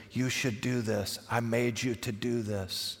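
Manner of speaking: soft, slow, hushed but still voiced; gentle whisper-like voice. God telling you what you were made for, hushed. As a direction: speak quietly and slowly, as if into an ear. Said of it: "God regularly whispers in your ear".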